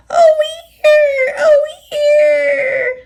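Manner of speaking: panicked trucks screaming in alarm. A narrator's reading. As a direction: shriek as you're yanked forward. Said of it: "they screamed".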